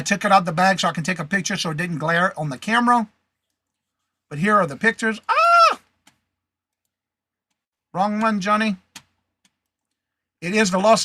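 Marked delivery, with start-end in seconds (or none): 5.3-5.8 sharp high yelp of surprise, much louder; startled yelp. Real, not acted. Seller sees the wrong photo on screen, startled high yelp. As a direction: caught off guard, cry out high.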